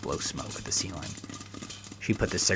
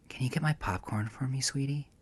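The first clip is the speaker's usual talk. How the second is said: sugary sweet; 6 semitones higher, 4 dB quieter than usual. Acted. Sarah sweetly asks for popcorn.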